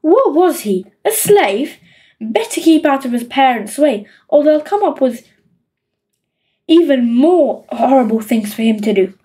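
plain reading voice

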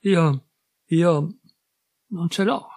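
rising, strained and tearful; a little boy's anguished stammer; painfully. A narrator's reading. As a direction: force the words out through anguish. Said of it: "Il bimbo riprese penosamente".

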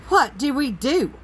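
high-pitched worried wail; high, distressed cry for help; panicked wail. Acted. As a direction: wail it helplessly. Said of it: "Arthur wailed".

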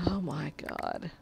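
unvoiced exasperated whisper; whispered oh my God. Real, not acted. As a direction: breathe it out in disbelief.

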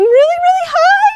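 "really, really high" squeaked up very high. Shown as a demonstration. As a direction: jump way up high on "high". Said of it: "changing the pitch of sound".

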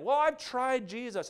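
high, drawn-out mock protest; mock protesting woman. Retold protest of rebellious young woman.

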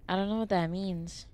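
ordinary talk

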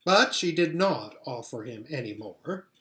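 usual speaking voice

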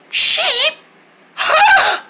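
put-on high, nervous child; little kid voice. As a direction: play a timid small kid.

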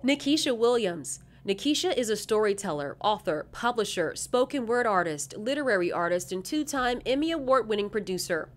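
measured, formal recorded read; bio read over music. Acted. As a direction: read the nominee bios like a video narration.

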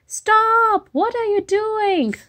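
alarmed high shout; high shouted stop. Acted. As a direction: yell at him to stop. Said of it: "So he says".

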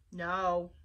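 a low, short refusal; Panguini refusing. Acted. Chef refusing Flytrap's request, low and blunt. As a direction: shut it down in one word.

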